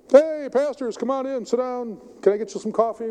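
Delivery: hearty welcome, high and loud at first; jovial. Retold or imitated. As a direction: play the friendly host greeting guests. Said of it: "real jovial".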